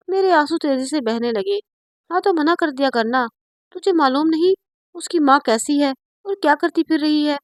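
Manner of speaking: ordinary delivery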